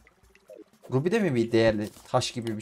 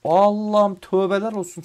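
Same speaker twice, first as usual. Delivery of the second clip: higher, louder fed-up groan of a prayer; exasperated outburst; 8 semitones higher, 8 dB louder, slower than usual. Real, not acted. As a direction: vent real annoyance. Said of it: "Çok sinirlendim gene ya". (gerçek bir bıkkınlıkla sitem ediyor)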